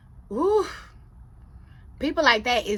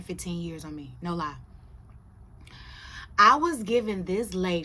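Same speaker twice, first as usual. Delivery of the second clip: usual speaking voice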